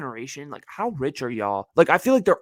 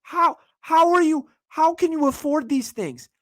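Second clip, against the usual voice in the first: incredulous self-quote; 12 semitones higher than usual. Retold or imitated. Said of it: "I'm like".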